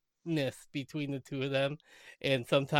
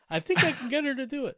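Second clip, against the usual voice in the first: scheming Larry; 10 semitones higher, 5 dB louder than usual. Retold or imitated. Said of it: "She owes me a favor".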